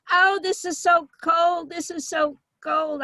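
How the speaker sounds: high, slow whiny mother; complaining mother. Retold or imitated. Mother griping at dinner in the club, high slow whine. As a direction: drag out the whine like a fussy old mother. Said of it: "she'd go".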